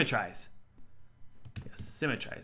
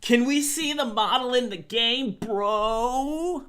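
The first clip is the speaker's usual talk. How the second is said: high, louder put-on voice; Jello; 12 semitones higher, 5 dB louder, slower than usual. Streamer voicing a viewer's chat request, high mock voice. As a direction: read it as a pushy high-pitched chatter. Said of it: "That's what Jello just said".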